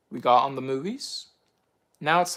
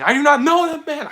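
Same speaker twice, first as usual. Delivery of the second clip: higher, louder insistent denial; Peter's denial; 11 semitones higher, 7 dB louder than usual. Retold or imitated. Peter denying Jesus, high and insistent. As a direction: deny it hard and fast. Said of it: "Peter was, like, saying".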